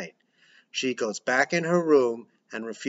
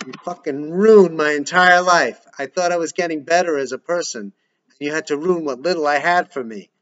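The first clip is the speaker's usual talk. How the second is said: furious yell, much louder; brutally angry; 3 semitones higher, 7 dB louder than usual. Acted. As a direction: scream at her brother in rage. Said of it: "brutally angry".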